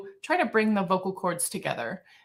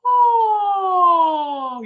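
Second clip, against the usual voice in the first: high, louder yawning oh; yawn preparation; 11 semitones higher, 7 dB louder than usual. Shown as a demonstration. Teacher modelling the start of a yawn. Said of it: "make the preparation for a yawn".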